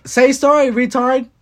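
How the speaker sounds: loud, high-pitched heckle; impatient demand. Real, not acted. Host pushes guest to get on with the story, loud impatient demand. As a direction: demand it loudly, out of patience.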